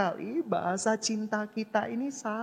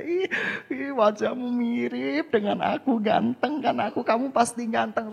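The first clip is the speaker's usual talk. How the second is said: higher, louder doting voice with a laugh; mock doting parent; 5 semitones higher, 4 dB louder than usual. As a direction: gush over the baby playfully. Adult coos to baby.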